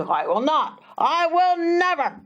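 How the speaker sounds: mock the stubborn boy; defiant boy. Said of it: "a way of talking that he had learned".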